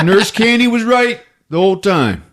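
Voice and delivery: ordinary delivery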